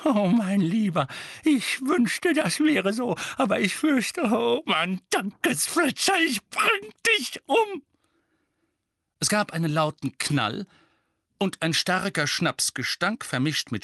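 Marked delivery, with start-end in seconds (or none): 0.1-7.8 high lament building to a threat; wail, then rise into a high, drawn-out threat; agitated Mrs. Figg. A narrator's reading.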